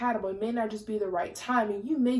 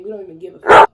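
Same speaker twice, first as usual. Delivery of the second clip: sudden loud outburst; 19 dB louder, faster than usual. Real, not acted. Venting about her ex-fiancé.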